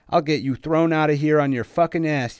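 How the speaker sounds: loud, high-pitched angry threat; bully yelling in someone's face; furious threat. A narrator's reading. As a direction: raise the voice hard, threatening to get someone kicked out. Bully threatens as fury rises.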